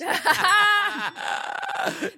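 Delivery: shouting burst of laughter; loud laugh. Real, not acted. Reacts to living in car.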